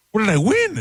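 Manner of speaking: high, eager retold question; hammed-up high-pitched excitement. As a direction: act out expecting a jackpot. Retelling his eager question to dealers.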